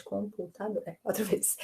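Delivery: "Computador" very soft, in separate syllables; quiet recount. Real, not acted. Teacher checks the count with the class, quiet syllable recount. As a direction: check "Computador" under your voice, piece by piece.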